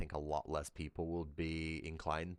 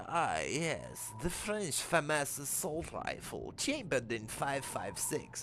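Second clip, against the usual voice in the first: slow, higher put-on Russian accent; Russian woman's voice; 8 semitones higher, slower than usual. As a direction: purr the rifle name in a slow Russian accent. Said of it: "and she's like".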